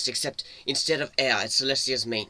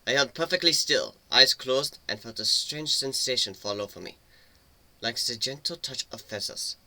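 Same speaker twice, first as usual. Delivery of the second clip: ordinary delivery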